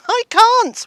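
high, loud, frantic yelp; panicked cry. Retold or imitated. Fleeing the bees, she cries out a loud, panicked refusal.

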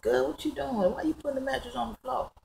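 high, light disbelief; retold puzzled question.

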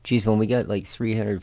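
ordinary talk, nothing marked

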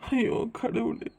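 slow, hushed groan of despair; a broken, drawn-out plea to God; despairing sigh. Acted. Husband outside the operating theatre, hushed and despairing.

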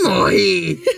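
laugh-cry through the word; laughing dying. Real, not acted. Gamer dying in-game, laughing and whining. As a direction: groan it out while laughing. Character just died.

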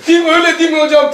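fast, high and loud, eagerly asking; a relieved passenger grabbing at comfort; nervous passenger's eager hopeful question; eager relief. Retold or imitated. Relieved passenger eagerly seeks reassurance.

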